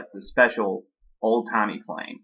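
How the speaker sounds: ordinary delivery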